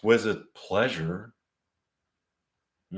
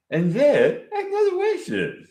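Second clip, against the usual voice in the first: much higher, giggling put-on waitress voice; Maud laughing; 4 dB louder than usual. Maud teases the couple, high giggling character voice. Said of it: "Okay, this is Maud's voice".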